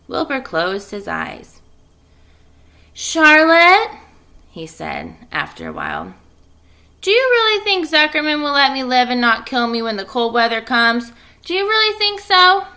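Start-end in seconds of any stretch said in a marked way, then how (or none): 2.9-3.9 put-on high and loud, a young pig calling; calling Charlotte. Wilbur calls to Charlotte. 7.0-12.7 put-on high, loud young pig voice pleading; frightened piglet asking his friend; anxious piglet. Wilbur anxious about being killed.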